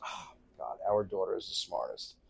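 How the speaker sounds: hushed, low awe; imitated marvelling wife. Husband imitating wife praising daughter, hushed mock awe. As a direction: sigh it softly in mock admiration.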